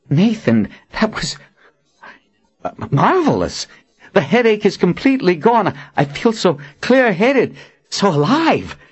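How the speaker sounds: high-pitched, marvelling delight; relieved Warren, elated. A narrator's reading. Healed Warren thanks Nathan for ending his headache, elated. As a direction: marvel at being free of pain.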